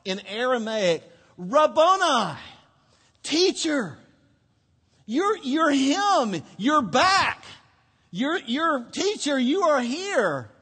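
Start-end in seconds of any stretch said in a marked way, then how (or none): 1.4-10.5 high, loud, slow cry of recognition; Mary recognising Jesus cries out in joy; joyful cry. Acted. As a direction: call out in overwhelmed joy. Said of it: "she cried out in Aramaic".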